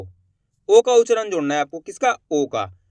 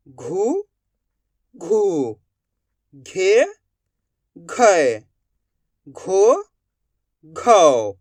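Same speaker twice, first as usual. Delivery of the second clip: alternating rising and falling syllables; drawn-out drill with a pause after each syllable; repeat-after-me recitation; much slower than usual. Shown as a demonstration. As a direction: recite the barakhadi for pupils to echo. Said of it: "मैं किस तरीके से इनका उच्चारण कर रहा हूँ".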